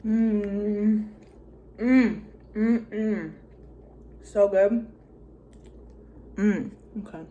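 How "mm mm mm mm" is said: mm of tasty food; savoring hum. Real, not acted. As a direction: hum with pleasure while chewing. Tasting noodles in mukbang.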